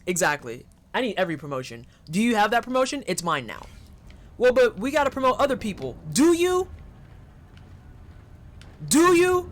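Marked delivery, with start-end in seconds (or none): 6.1-9.5 high, loud, taunting challenge; mock menace. Coworker mock-threatening over promotions, high taunting. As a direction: dare them to say it again.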